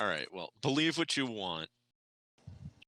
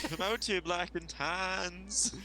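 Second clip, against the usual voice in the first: higher, slow mock Irish taunt; mock Irish accent; 6 semitones higher, much slower than usual. Player taunting in a slow, higher mock Irish accent.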